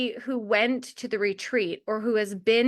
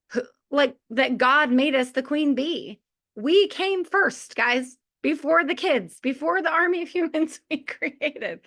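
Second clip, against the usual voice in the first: ordinary talk, nothing marked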